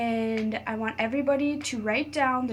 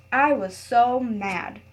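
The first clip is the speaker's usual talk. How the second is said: a louder, slower stretched refrain; reading to children; 7 dB louder, much slower than usual. A narrator's reading. Teacher rereading so kids tally the word mad, emphatic read-aloud. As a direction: lean into the refrain so the kids catch it.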